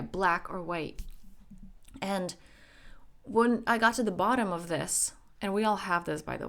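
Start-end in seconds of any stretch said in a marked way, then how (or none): 0.0-1.0 slow emphasis. Shown as a demonstration.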